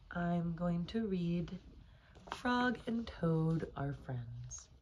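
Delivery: usual speaking voice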